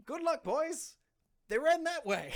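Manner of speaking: put-on dwarf waving the boys on in a high loud voice; cheery dwarf. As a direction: shout them off in a tiny shrieky voice. Dwarf sends the chasers off.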